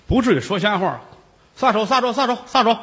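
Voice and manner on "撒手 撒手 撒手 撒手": higher, insistent order to let go; urgent command. Acted. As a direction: snap them off him. Official orders them to let go.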